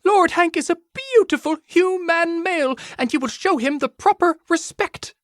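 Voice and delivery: put-on very high indignant rabbit girl; squeaky outrage defending her lord; small-voiced shouting. A narrator's reading. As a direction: scold them in a tiny, high-pitched shout. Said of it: "as much as her voice could shout".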